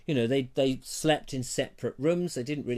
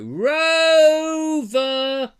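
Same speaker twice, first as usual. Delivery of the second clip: long high shout across the park; calling a dog; 12 dB louder than usual. Retold or imitated. Man in the park calls his dog Rover, long loud shout. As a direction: call the dog from far away, much higher and louder.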